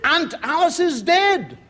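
high, slow mock-solemn announcement; theatrical father breaking news; grave announcement. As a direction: proclaim sad news, stretching each word.

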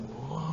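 hushed, drawn-out whoosh; very quiet soaring sound; soft airy "whoa". Retold or imitated. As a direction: imitate gliding off on the wind. Imitates soaring on air currents.

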